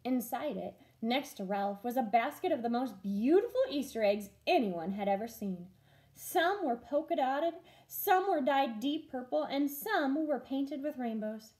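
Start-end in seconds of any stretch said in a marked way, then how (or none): none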